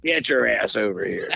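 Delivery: lightly imitated old Irishman giving orders; mock Irish summons, put on for the story. Retired policeman summoning a drinking companion, mock Irish voice. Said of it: "in his old Irish voice".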